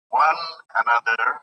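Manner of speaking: "one another" drawn out slowly and loudly, split into parts; slow, loud metrical reading of "one another"; divided syllables. Shown as a demonstration. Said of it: "You see the way it is divided".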